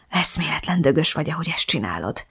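hushed, admiring near-whisper; full of admiration. A narrator's reading. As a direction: let awe soften the voice right down. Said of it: "sugárzott a hangjából a csodálat". (csodálattal teli, halk suttogás)

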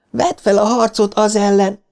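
very high urgent cry, volume contained; screamed plea. A narrator's reading. Beverly urges Bill to fight, high screamed plea. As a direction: shriek it as a rallying plea. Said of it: "sikoltotta Beverly".

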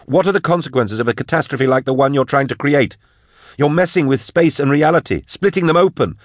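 high, urgent, angry tirade; yelling. A narrator's reading. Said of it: "Drusha yelled at the cage".

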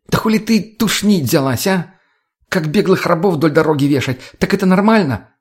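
high, indignant challenge; heated pitch without a full shout; flaring up angrily. A narrator's reading. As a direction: lay into someone accusingly. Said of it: "Взъелась Лена".

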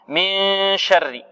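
very slow chanted من شر with a hidden nasal; slow recitation demo. Shown as a demonstration. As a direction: draw out من شر to show ikhfa. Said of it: "هنا إخفاء".

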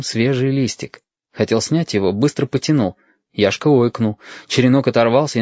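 plain reading voice

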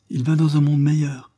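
high, mildly tearful consolation; stifling sobs. A narrator's reading. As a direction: hold back sobs while consoling. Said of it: "en étouffant elle-même les sanglots".

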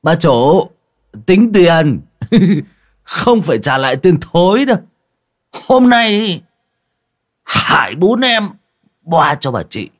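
put-on loud, high drunken slur; slurred drunk voice. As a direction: slur it like a tipsy bro. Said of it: "giọng lè nhè".

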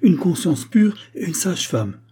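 high-pitched, scandalised retort; affronted exclamation; indignant. A narrator's reading. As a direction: throw it back in outrage. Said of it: "S'écria madame de Géry avec indignation".

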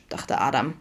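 ordinary delivery

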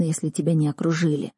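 ordinary delivery, nothing marked

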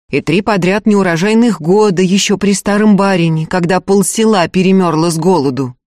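ordinary delivery

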